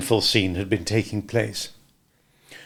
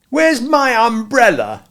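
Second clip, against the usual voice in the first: higher and louder, slow demanding voice; indignant demand; 12 semitones higher, 5 dB louder, slower than usual. A narrator's reading. Walderwick confronting Smith about his umbrella, indignant. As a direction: demand it back, affronted. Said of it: "demanded the pink one".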